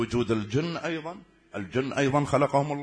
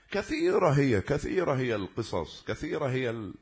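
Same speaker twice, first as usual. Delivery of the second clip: ordinary delivery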